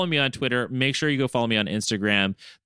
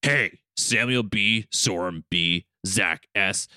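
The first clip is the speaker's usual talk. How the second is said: Logan impression; 3 semitones lower than usual. Co-host as Logan thanks top-tier supporters, low gruff-hero impression. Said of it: "have Logan come back and be like".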